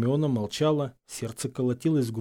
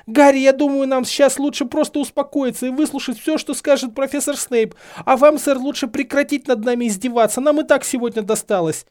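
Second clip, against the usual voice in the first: high, loud, insistent pleading; frantic appeal; 13 semitones higher, 7 dB louder than usual. A narrator's reading. Hermione calming Harry and Snape, high and desperate. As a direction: urgently talk both of them down.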